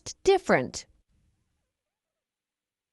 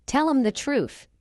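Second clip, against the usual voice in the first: quick, higher "Tell 'em the truth"; fast English; 4 semitones higher, faster than usual. Shown as a demonstration. Teacher trains learners' ears, fast. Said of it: "fast English is not difficult".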